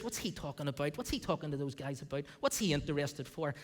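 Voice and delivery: low, soft sneering mutter; a jealous older brother muttering his contempt. Acted. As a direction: belittle him under your breath. Eliab voiced sneering, low and soft.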